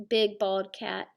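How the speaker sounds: ordinary delivery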